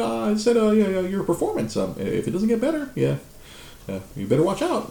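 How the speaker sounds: high, mocking voice for a smug coworker; high, prissy threat mimicking the coworker; mocking Martin. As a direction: squeak it out like a smug lapdog. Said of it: "He said".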